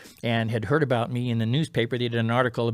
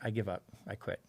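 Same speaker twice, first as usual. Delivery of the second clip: much softer, voice dropping away; defeated surrender; 3 semitones lower, 10 dB quieter than usual. Retold or imitated. Stressed racer surrenders to God on the battlefield, defeated. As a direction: give up quietly, lower and fading to almost nothing.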